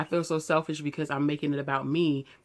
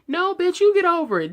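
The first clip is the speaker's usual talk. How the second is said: much higher and louder, snapping back; furious retort; 14 semitones higher, 8 dB louder than usual. Acted. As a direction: shout it straight back at them. Retort to dismissive people.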